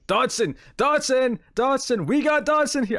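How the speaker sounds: loud, high hammed-up yelling; Nedry yelling the name at the restaurant; shouting Dodson. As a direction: yell the name across the room. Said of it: "I love that moment".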